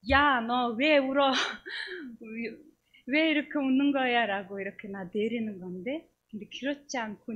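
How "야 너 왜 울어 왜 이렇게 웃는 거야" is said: loud mock scolding, like an upset mother; 꾸짖는 어머니. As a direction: scold loudly, voice raised. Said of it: "우리 어머님이었으면". (큰 소리로 야단치는 엄마 흉내)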